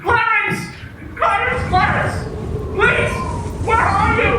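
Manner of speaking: desperate man calling for his guardian angel; calling out desperately. Acted. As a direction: shout for Clarence to come back.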